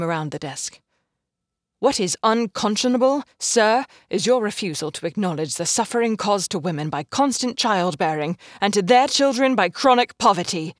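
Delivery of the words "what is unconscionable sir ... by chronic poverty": loud, fierce indictment; doctor confronting her employer about suffering women; furious accusation. Acted. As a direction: throw his word back at him.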